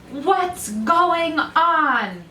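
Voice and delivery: slow, high booming question; startled elephant. A narrator's reading. Elephant to the frightened rabbits and bears, slow booming question.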